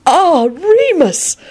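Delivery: put-on high, slow, delighted old man; beaming old wizard. A narrator's reading. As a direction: beam with delight. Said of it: "Dumbledore beamed".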